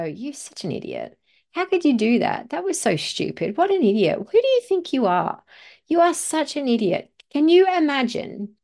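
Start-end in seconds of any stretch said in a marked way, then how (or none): 0.0-7.1 acted-out parent's tirade, increasingly indignant; scolding a child. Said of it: "look them in the eye and go".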